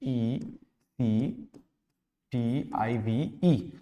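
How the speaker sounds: slow letter-by-letter spelling, paused between groups; spelled out in syllables. Shown as a demonstration. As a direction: spell it slowly, one syllable group at a time. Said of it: "syllable बनाएं तो कैसे बनेगा". (धीरे-धीरे अक्षर तोड़कर spelling)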